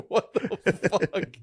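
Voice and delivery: slow, high, laughing what the fuck; stunned, giggling disbelief. Retold or imitated. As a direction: drag it out through a laugh. Retold reaction to podcast, laughing.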